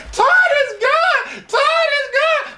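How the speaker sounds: mock moaning woman. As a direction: ham up the woman shouting through the wall. Woman shouting during sex, retold.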